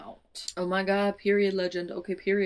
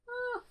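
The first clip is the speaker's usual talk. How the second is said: soft, very high squeak; tiny high squeal; 6 dB quieter than usual. Real, not acted. Reader reacts to the wish revelation with a tiny squeal. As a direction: squeak quietly in suspense.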